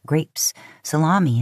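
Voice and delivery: ordinary delivery, nothing marked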